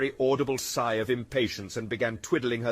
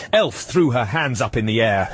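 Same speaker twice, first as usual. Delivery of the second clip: plain reading voice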